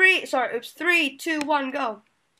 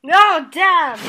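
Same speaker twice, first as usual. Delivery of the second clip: loud cry of frustration; dismayed shout; 2 semitones higher, 9 dB louder than usual. Real, not acted. Player gets shot off in game, shouting. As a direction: yell out at losing the round. Loses round in shooting game.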